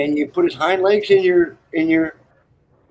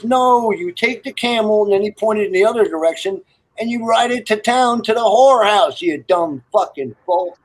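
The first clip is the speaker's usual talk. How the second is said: mock angry sergeant; 6 semitones higher than usual. Sergeant yelling in joke.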